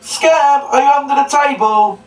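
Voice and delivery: high, loud call muffled behind cupped hands; muffled through hands. Shown as a demonstration. Children's entertainer calls for the hidden puppet dog, high and muffled. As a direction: call out as if through a table, hands over mouth. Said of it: "put your hands over your mouth like this".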